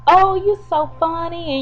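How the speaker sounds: high, slow mock-amused voice; laughing it off. Woman brushes off his threat, high slow feigned amusement. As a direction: draw it out high, playing it as a joke.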